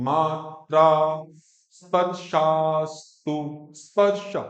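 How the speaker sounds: मात्रा स्पर्शास्तु very slow, syllable by syllable; model recitation. Shown as a demonstration. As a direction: stretch मात्रा स्पर्शास्तु out for pupils to copy. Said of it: "बोलो".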